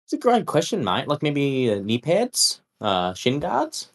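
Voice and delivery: put-on voice of a polite customer; chummy "mate" character. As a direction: play a matey shopper asking a favour. Player answers vendor in character.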